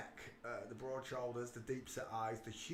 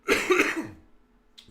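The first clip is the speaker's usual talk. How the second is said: loud cough; 17 dB louder than usual. Real, not acted. Loud cough between lines.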